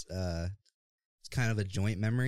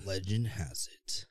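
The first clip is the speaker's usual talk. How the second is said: lightly deep, slow legend voice; a hint of a fantasy movie narrator; epic narrator voice. Shown as a demonstration. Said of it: "the deep, groggly voice, like a Lord of the Rings movie".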